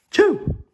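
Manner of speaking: high, loud "choo"; acted sneeze. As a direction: let out a pretend sneeze. Gerald sneezes in the story.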